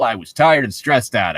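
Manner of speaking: normal talking voice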